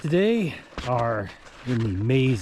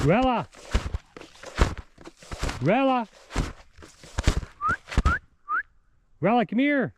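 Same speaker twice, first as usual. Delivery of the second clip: calling the dog; 14 semitones higher than usual. Real, not acted. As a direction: shout her name out to the dog.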